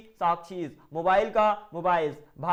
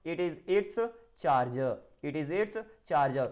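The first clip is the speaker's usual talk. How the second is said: "it is its charger" said very slowly; slow model answer; 4 semitones lower, much slower than usual. Shown as a demonstration. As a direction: drill "it is its charger" word by word. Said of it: "तो आप क्या बोलोगे". (बहुत धीरे "it is its charger")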